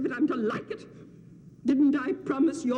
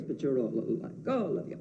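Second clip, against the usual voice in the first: normal talking voice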